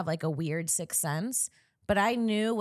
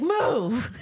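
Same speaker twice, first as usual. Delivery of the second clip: sharp loud command; a retold angry dad shouting move; angry dad bark; 5 semitones higher, 5 dB louder than usual. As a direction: snap it like a dad losing his temper. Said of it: "like an angry dad losing his temper".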